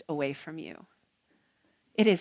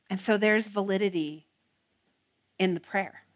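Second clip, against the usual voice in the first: ordinary talk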